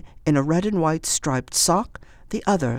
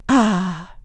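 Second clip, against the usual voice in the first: relieved sigh; 6 semitones higher, 4 dB louder than usual. Acted. Old woman relieved.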